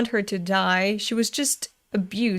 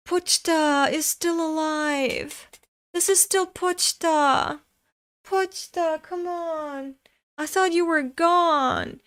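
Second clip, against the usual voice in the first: deeply moved; 4 semitones higher than usual. Real, not acted. Fan finding Pochta still there for Denji, moved high wail. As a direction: wail it tenderly, close to tears.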